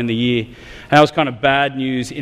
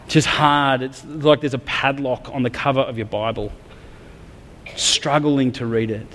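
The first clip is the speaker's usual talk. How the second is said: ordinary talk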